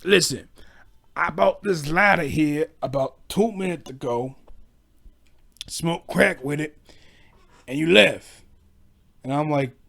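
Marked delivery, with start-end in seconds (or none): 0.0-8.4 slow, drawn-out street-guy voice; a light imitation of a spaced-out crack user; crackhead customer. Crack user returning a lighter to the clerk, slow mock voice. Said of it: "Old cracks be like".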